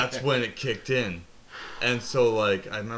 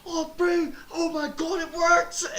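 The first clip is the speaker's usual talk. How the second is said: excited Kiwi mate. Retold or imitated. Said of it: "my friends running down the street like".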